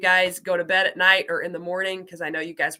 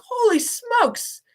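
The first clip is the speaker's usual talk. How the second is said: high-pitched retold amazement, drawn out; astonished reaction; 11 semitones higher, 5 dB louder, slower than usual. Teacher retelling her reaction to practice exams, high-pitched astonishment. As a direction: blurt it out in playful overwhelmed disbelief. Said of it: "and I'm like".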